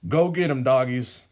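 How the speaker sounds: high, loud call to attack; old villain calling his dogs to attack; command to dogs. A narrator's reading. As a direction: sic the dogs on them. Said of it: "He then whistles and says".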